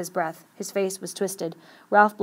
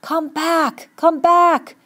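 high, loud desperate yell; drawn-out, high-pitched shout; screaming; 10 semitones higher, 9 dB louder, much slower than usual. A narrator's reading. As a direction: scream after something far away and leaving. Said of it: "and screamed at the ship".

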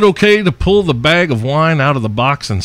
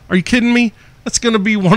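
excited disbelief; 9 semitones higher, faster than usual. Real, not acted. Dream interview idea.

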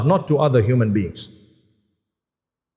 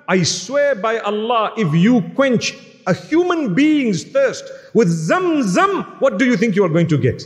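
impassioned plea; 6 semitones higher than usual. Retold or imitated. Impassioned plea to the airport brother.

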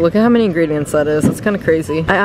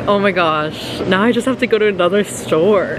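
ordinary talk

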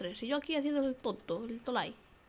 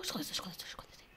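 hushed, repeated whisper; breath-only urging; urgent whisper; 6 semitones lower, 11 dB quieter than usual. Real, not acted. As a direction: whisper it to stay hidden. Hiding from another player.

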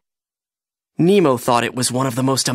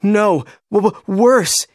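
high, stuttering fright; frightened stammer; 6 semitones higher, slower than usual. A narrator's reading. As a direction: stammer it out in a scared, raised voice. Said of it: "quivering in fright".

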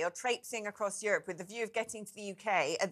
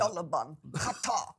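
put-on Arabic accent; 5 semitones lower, slower than usual. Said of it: "in a strange Arabic voice".

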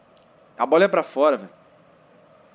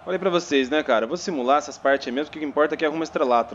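ordinary delivery

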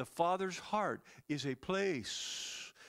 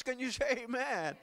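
normal talking voice

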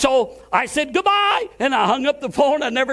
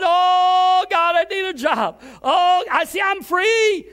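overwrought pleading prayer; 6 semitones higher, slower than usual. Shown as a demonstration. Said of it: "I didn't go on and on".

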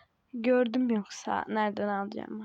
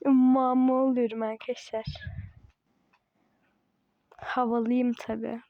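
ordinary talk

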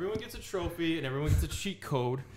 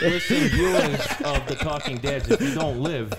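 mock announcer; 6 dB louder than usual. Podcast host addressing viewers, loud mock announcer. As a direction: proclaim it like a show host.

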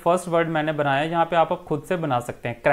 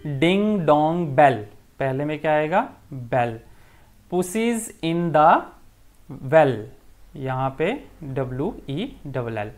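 very slow, sing-song recital; teacher slowly recites Ding Dong Bell for the class, sing-song; nursery rhyme reading; much slower than usual. Acted. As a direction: read it out slowly like a rhyme for children.

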